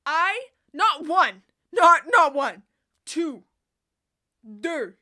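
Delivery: loud, slow, emphatic excitement; excited shout. Real, not acted. Vlogger boasting about two pairs of boots, loud. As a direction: hammer out each word with glee.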